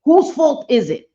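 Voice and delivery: loud high indignant questions; real heated indignation; fired-up. Real, not acted. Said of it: "I get fired up".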